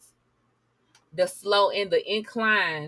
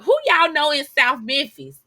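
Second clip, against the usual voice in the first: higher and louder, calling out the viewers in disbelief; loud disbelieving call-out; 6 semitones higher, 7 dB louder than usual. Real, not acted. Vlogger calls out the suspects at full volume in disbelief. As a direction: demand to know who they know there. Incredulous aside at news anchor.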